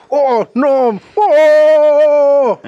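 loud, high mock cry of alarm; overacted video game yelp; hammy game cry. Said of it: "la mejor actuación es de".

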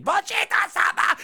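very high, strained mock anime cry; mimicked battle cry. Reviewer recalling Vegeta's big moment, mimicked high cry.

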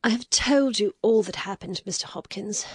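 tired, breathy near-whisper; worn out, barely voicing it; weary. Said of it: "she said wearily".

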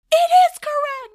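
very high, very loud protest; acting out a desperate insistence; indignant scream. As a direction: shriek it in mock outrage. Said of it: "I'm literally on the ground like".

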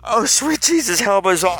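normal talking voice

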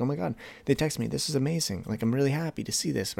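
high-pitched, delighted reaction; happily surprised. Acted. Texter pleased by a nice little surprise, happily surprised. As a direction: act out being thrilled, voice lifted. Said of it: "supposed to be a nice little surprise".

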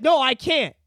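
high, loud and slow bratty shout; bratty kid yelling back. Retold or imitated. Nine-year-old yelling at his parents over chores, bratty shout. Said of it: "I'd be like".

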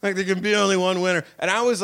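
ordinary delivery

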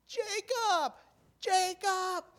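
very high, loud shout of a name; full-lung yell. Retold or imitated. Man in the parking lot calling for Jacob, yelling. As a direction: yell it at the top of your lungs. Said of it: "yelling at the top of his lungs".

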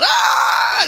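high mock scream; imitated scream. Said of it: "She's going, ... screaming".

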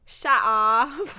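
loud playful shut-up; mock shout. Teasing the co-host over an accent, loud mock shout. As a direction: shout it in jest.

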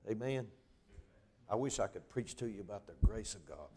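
ordinary talk, nothing marked

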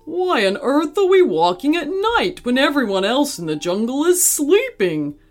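high, exasperated question; complaining. Acted. Gorilla on midnight walk, complaining. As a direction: complain with pitch pushed up.

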